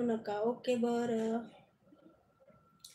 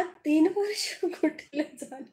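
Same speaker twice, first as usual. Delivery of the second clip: laughing; 4 semitones higher, 4 dB louder, slower than usual. Real, not acted. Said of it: "हसायला काय झालं?".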